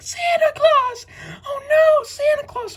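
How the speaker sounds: put-on very high, loud, slow wailing kids' voices; wailing kids. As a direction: cry out like heartbroken children for Santa.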